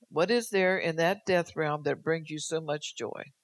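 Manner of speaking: ordinary talk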